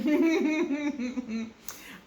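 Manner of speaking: bright amused laugh; a real high giggle. Real, not acted. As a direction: burst out laughing. Laughing at her own story.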